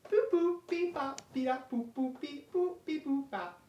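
soft high robot beeps; toy robot voice. Pretend. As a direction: beep it like a little toy robot. Beeping toy robot noises.

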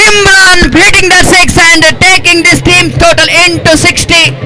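high, lively play-by-play; excited cricket commentary. Real, not acted. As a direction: keep the thrill of the six going. After the six, still raised.

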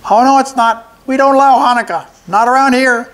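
high, loud squeaky goblin; a pipsqueak goblin refusing; little goblin voice. A narrator's reading. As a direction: play the small goblin, high and defiant. First goblin voiced in story.

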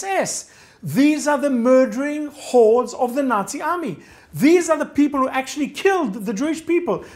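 high, loud, emphatic indignation; horrified outrage. Retold or imitated. Narrator facing a Nazi war criminal, horrified outrage. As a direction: hammer it out in appalled disbelief. Reliving horrified discovery of SS man.

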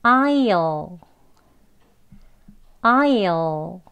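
I'LL long and full, "ai-ul"; basic contraction. Shown as a demonstration. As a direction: model "I'll" slowly with the whole I sound. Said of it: "이제 기본 발음이에요".

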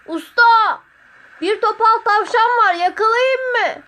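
very high, loud distant holler; shouting from above. A narrator's reading. Sotiri yells down to his master about a hare, shouting. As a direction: holler down to someone far below. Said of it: "Yukarıdan yabani kerevizlerin içinden bağırıyordu".

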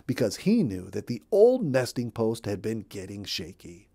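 ordinary delivery, nothing marked

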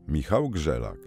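ordinary delivery

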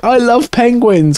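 slow, high-pitched mimicked delight; imitating Hadrian. Quoting an excited viewer of a nature show, delighted mimicry. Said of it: "Hadrian was like".

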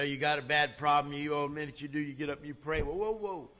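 normal talking voice

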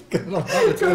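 laughing hard. Real, not acted. Both crack up laughing.

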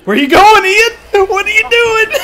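high, loud hunting taunt; 1 high monster shout. Pretend. Monster chasing Ian down, shouting high and loud.

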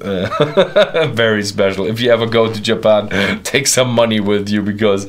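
laughing through the words; real laughter breaking into the line. Real, not acted. Laughing while recalling Kobe beef.

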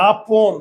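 "আপন" loud, as aa-pon; closed-jaw o. Shown as a demonstration. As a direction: say "আপন" with a rounded o, jaw barely open. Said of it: "চোয়াল খুলে না".

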